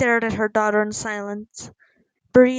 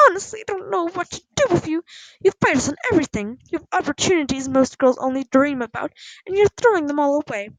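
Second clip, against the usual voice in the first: fast, higher-pitched reproach on the edge of tears; upset, tearful scolding; 5 semitones higher, 4 dB louder, faster than usual. A narrator's reading. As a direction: pour out exasperation at a daughter. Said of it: "she started to sob quietly".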